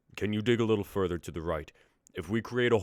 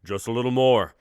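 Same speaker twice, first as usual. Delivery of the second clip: much louder straining encouragement; urging shout; 6 semitones higher, 13 dB louder than usual. Acted. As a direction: push them on loudly. Urging during the lift.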